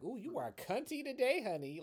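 high, sassy queen voice; sassy drag queen. Retold or imitated.

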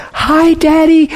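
high, sweet-talking little greeting; daughters' greeting. Retold or imitated. Girls greet their dying daddy, imitated high greeting. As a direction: pitch it way up like the girls. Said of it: "watching the girls. They're like".

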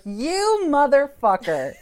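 slow, loud, high outraged swear; indignant curse. Retold or imitated. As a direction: drag it out in disbelief. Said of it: "And I was like".

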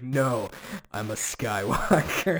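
cheesy movie hero proclaiming; cheesy hero line. Retold or imitated. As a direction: ham up the big hero moment. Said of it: "says something cheesy".

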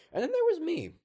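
very high, loud yell; acted shrieking announcement; sudden outburst. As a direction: burst out at the top of your voice. Bursting out about himself.

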